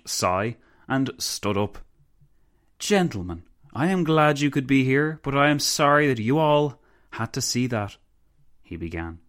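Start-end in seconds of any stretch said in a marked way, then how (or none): 2.8-8.0 raised-pitch formal address, mildly smug; lightly acted politician opening a speech; lightly voiced self-indulgent delegate. A narrator's reading. Bratianu addresses gentlemen after an awkward exit, lightly voiced self-indulgence.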